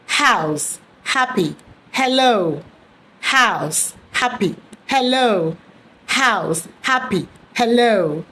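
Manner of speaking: house, happy, hello each opened with a breathy H; clear H drill. Shown as a demonstration. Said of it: "try articulating these words".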